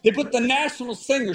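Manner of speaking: ordinary talk, nothing marked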